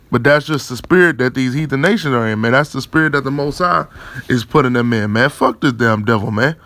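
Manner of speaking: ordinary delivery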